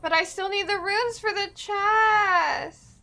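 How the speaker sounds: mock wail. Real, not acted. Greedy gamer whining over loot.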